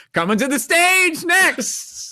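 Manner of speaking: loud, high, slow announcer intro; a hammed-up club host introducing the comic; emcee announcing. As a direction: boom out the next act like an MC. Voicing the club MC.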